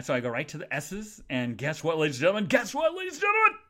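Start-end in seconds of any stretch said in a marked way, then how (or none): 1.6-2.4 announcer-like tease, rising at the end; building excitement. Real, not acted. Podcaster announcing her find to listeners, building excitement. 2.4-3.6 very high, loud squealed announcement; excited shout. Real, not acted. Podcaster announcing her find to listeners, excited shout.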